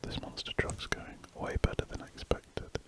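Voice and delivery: normal talking voice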